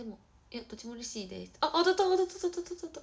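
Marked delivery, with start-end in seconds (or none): 1.6-3.0 high, loud burst of excitement; real thrilled squeal of words; excited outburst. Real, not acted. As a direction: blurt it out repeatedly, high and loud. Sudden hit during the livestream.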